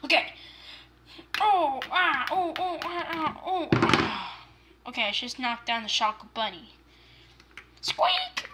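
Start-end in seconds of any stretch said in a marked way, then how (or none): 1.4-4.5 string of play-fight oohs and ahs; make-believe battle cries; pretend fight grunts. 7.8-8.5 very high squeaky bunny; one piercing little bunny squeak; chocolate bunny squeak. Pretend. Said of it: "jumping around and saying squeak".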